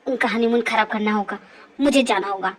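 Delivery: very low male character voice, talking to himself; resolute man. Acted. Man plotting to stop the honeymoon, resolute. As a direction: sink the voice low, set on a plan.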